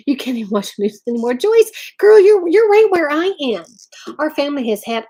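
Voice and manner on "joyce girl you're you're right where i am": high, loud burst to a viewer; excited call-out. Real, not acted. Calling out to a viewer Joyce, excited and loud. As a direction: call out to Joyce, thrilled to agree.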